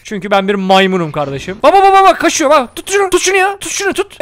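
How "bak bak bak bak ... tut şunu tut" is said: high-pitched, frantic urging; excited high squeal of alarm; urgent chase cries. Real, not acted. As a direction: urge them to grab the runaway. Urges catching someone running away.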